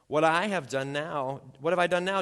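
what did I do, loudly; indignant protest. Acted.

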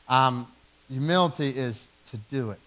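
usual speaking voice

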